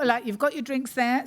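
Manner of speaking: ordinary delivery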